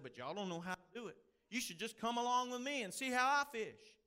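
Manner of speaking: high, loud Southern yarn-spinning; Jerry Clower's Southern accent. Acted. Preacher retelling a comedian's fishing yarn to the congregation, in a Southern accent. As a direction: tell it as an excited old Southern comedian, in a thick Southern accent. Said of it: "try to tell it the way Jerry tells it".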